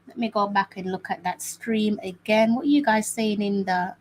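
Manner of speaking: usual speaking voice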